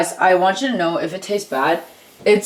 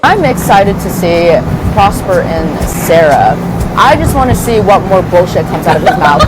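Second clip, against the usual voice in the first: loud excited; 8 dB louder than usual. Real, not acted. Loud tipsy ranting on camera.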